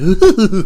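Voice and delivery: loud high burst of odd laughter; real giddy laugh; weird laugh. Real, not acted. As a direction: let out a strange gleeful cackle. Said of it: "how did I laugh like that?".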